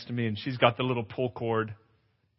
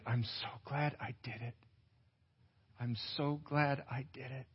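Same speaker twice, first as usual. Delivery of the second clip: soft repeated relief; 10 dB quieter than usual. Retold or imitated. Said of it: "Annika saying over and over".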